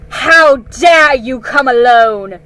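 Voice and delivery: slow, very loud furious queen; terrible voice. Acted. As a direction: thunder it down at the boy. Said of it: "said the witch in a terrible voice".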